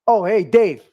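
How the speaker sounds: loud retold greeting to Dave. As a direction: hail a coworker at the checkpoint. Guards greet Dave at checkpoint.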